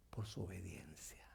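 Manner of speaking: hushed whisper for emphasis. Acted. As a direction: drop to a breath on God. Whispers key phrase.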